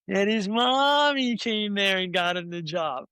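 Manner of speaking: slow mocking announcement, "mommy" drawn out; retold public ribbing; teasing. Retelling the story that teased him.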